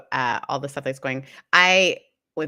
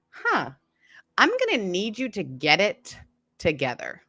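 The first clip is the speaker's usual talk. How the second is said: firm demand; 4 semitones higher than usual. Retold or imitated. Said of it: "the thing that I said that".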